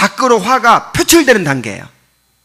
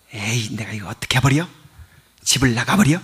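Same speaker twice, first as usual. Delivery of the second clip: 낮고 작게 혼잣말하듯 흉내; 속으로 꿍꿍이를 중얼거리듯 작게; 7 semitones lower, 8 dB quieter than usual. Retold or imitated. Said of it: "악의가 뭐죠?". (악의 품은 사람의 속마음을 작게 흉내)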